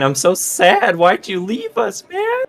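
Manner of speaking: whiny fake sobbing, rising high; mock crying. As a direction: blubber it, hammed up. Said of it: "start crying".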